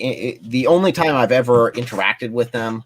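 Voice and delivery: usual speaking voice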